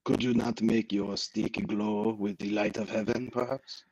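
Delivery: dry fake French retort; bad French accent. [name] objects to her glowing stick, bad French accent. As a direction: answer back drily in a hammy French accent. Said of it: "in a very bad French accent".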